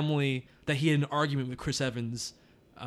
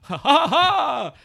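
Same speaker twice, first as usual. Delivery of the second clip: loud, high, drawn-out ha-ha-ha; exaggerated stagey laugh, much higher; mock laugh; 13 semitones higher, 8 dB louder, much slower than usual. Reacting to a joke about spoilers.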